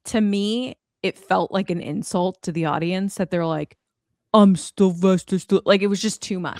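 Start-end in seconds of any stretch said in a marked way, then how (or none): 4.3-5.6 mock Stallone voice. Mocking the trailer's overdone Stallone voice to friends. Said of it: "the voice was too much".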